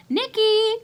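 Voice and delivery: high, loud call of the name; mother calling. Acted. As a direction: call up from the kitchen as Ma. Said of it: "called Ma from the kitchen".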